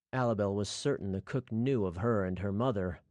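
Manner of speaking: plain reading voice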